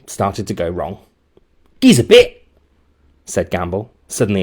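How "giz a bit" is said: put-on much higher, loud rough kid voice; rough bully demand. A narrator's reading. Gamble barging in to grab the donut, loud. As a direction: demand it like a playground thug.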